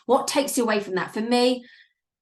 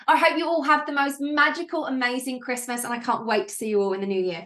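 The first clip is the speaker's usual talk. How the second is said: normal talking voice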